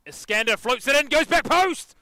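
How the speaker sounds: loud call rising to a high shout; excited match call. Real, not acted. Commentator calls Iskander's corner, building to excited shout. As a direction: lift pitch and volume as the ball travels.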